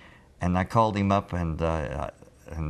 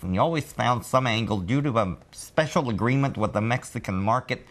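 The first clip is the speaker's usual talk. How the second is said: higher, lisping car-dealer pitch; 6 semitones higher than usual. Retold or imitated. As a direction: sell it like a lisping car salesman. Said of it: "he sort of sounded like this".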